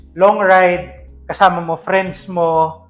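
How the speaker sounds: normal talking voice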